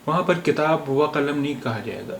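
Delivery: ordinary talk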